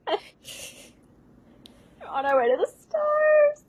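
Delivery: high, sing-song melodrama; mock dramatic line. Host quoting the stage line back, high mock drama. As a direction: float it up like a diva. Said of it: "put that in my vocabulary".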